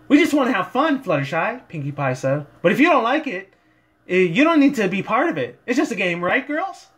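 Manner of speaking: high, bouncy, excited pony voice; bubbly party pony bursting with energy; hyped-up Pinkie Pie. Acted. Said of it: "Pinkie Pie tried to bring a lot of her, like, hypeness".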